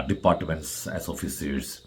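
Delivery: ordinary talk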